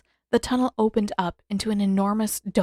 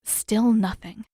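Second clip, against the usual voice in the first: usual speaking voice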